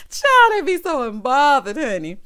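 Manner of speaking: loud, high-pitched outburst; high, loud, delighted exclamation; amused exclamation. Real, not acted. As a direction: cry it out, tickled, straight after laughing. Exclaims after laughing hard.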